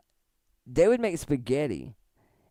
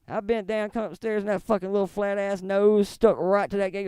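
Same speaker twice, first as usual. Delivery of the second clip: normal talking voice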